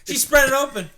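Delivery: loud, high, slow jeering with laughs; laughing high mock taunt; taunting kid. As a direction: drag it out like a kid mocking a classmate. Kids mocked at school.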